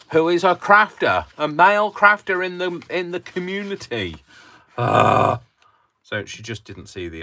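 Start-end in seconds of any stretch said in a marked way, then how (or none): 0.1-4.2 very high, loud comic exclamation; mock-incredulous outburst. Crafter pointing out the irony to viewers, high incredulous outburst. 4.8-5.4 loud, high mock-exasperated "ah"; exasperated yell. Crafter fed up with the irony, loud exasperated cry. Caps his mock outrage.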